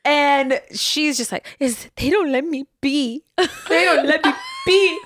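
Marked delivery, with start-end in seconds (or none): 1.6-5.0 high, laughing mock wail of mom; whiny mom impression. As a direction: imitate mom complaining while laughing. Voicing upset mom retelling.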